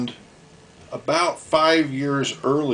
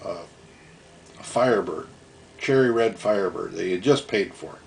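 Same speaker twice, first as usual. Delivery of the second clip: ordinary talk, nothing marked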